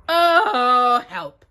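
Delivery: a touch of bear distress; stuck Pooh. A narrator's reading.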